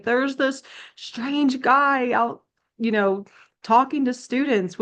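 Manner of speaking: high-pitched mock tattling lady; complaining caller. Lady calling the cops on a preacher, mock alarmed. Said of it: "called the cops and was like".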